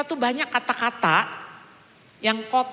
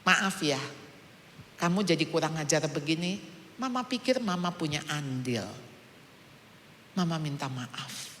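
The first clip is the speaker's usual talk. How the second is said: put-on gentle, quieter sincere apology to a child; soft apology; 7 semitones lower, 7 dB quieter than usual. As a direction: say sorry softly, as a humbled mother. (permintaan maaf yang lembut dan tulus)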